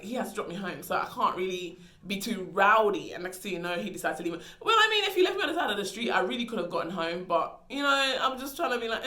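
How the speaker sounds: ordinary talk, nothing marked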